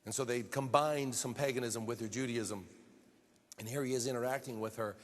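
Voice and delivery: ordinary talk, nothing marked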